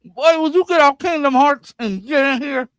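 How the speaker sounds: mock parent yell. Yelling at friend to join.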